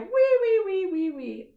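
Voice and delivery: high squealing wee wee; piggy squeal. Acted. Finger play for a child, piggy squealing high. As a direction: squeal like a little pig. Piggy cries all home.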